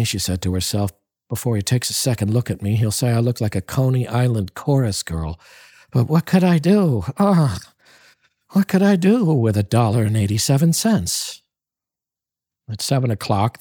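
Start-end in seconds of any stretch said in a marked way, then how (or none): 1.3-11.4 anxious Della. Acted.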